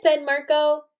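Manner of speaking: lively read-aloud to a class, voicing the kids; story narration. A narrator's reading. Teacher reading a story to her class, lively read-aloud. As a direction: read it like a picture book to children.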